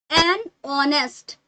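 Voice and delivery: "honest" said slowly with no h sound; silent h. Shown as a demonstration. Teacher models the article before honest, slow, h silent. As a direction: draw out "an honest", starting honest on the vowel. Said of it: "हम यहाँ पर हॉनेस्ट नहीं बोलेंगे".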